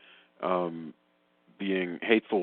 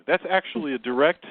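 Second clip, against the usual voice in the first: normal talking voice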